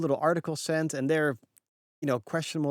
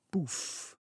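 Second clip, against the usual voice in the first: whispered poof; 2 semitones higher, 10 dB quieter than usual. Pretend. Sound effect as track flattens.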